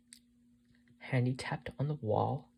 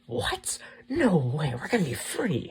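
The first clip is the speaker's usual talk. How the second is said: high, loud burst of disbelief and joy; excited yell; 5 semitones higher, 10 dB louder, slower than usual. A narrator's reading. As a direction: yell it out, thrilled. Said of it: "Handy yelled through the tiny hole".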